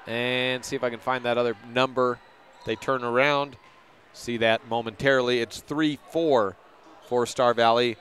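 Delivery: ordinary delivery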